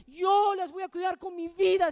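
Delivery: loud, slow pleading vow to God; fervent prayer. Retold or imitated. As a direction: plead it with all your heart, slowly. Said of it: "así le oraba".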